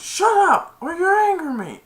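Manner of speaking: high, loud shouted warning; imitated angry threat. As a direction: shout back as a riled player. Retold threat.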